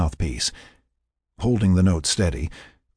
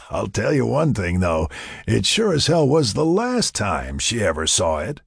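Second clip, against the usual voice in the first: amused, slightly raised voice; chuckling; 3 semitones higher than usual. A narrator's reading. Mac joking with a laughing Sarah, chuckling. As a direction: tell it with a chuckle. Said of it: "Mac chuckled".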